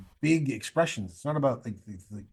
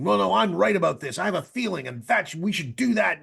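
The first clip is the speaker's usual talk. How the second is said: loud, higher pitched insistence; insistent; 7 semitones higher, 5 dB louder than usual. Retold or imitated. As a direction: push it hard, certain you're right. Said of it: "getting up and saying".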